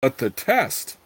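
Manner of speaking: plain reading voice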